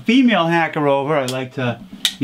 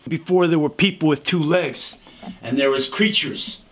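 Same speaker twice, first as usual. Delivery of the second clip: ordinary talk, nothing marked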